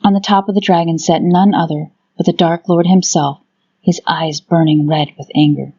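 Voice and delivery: ordinary delivery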